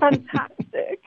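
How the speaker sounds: high, drawn-out posh English; playful posh English accent. Shown as a demonstration. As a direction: exclaim slowly in mock upper-class English. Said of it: "extremely posh".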